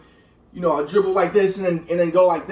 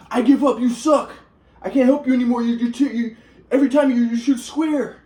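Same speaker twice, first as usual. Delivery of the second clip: higher, mocking dad voice; 6 semitones higher than usual. Son imitates his dad quitting on him, mocking and higher. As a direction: sneer it like a fed-up father.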